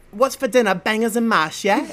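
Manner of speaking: chirpy mock British child at dinnertime; British accent. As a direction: put on a caricature British accent. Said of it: "imagine having a child".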